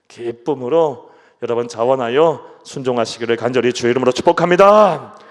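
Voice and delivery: rising to a loud earnest 부탁합니다; fervent loud plea. Real, not acted. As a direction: plead loudly in the Lord's name. Closing blessing climax. (간절하게 크게 부탁하며)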